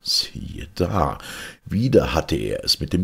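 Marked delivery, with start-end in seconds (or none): none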